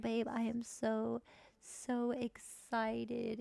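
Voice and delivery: slow, stretched "so, so excited"; lightly voiced excited wife; drawn-out mock excitement. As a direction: linger on the so's. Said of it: "she's gonna be like".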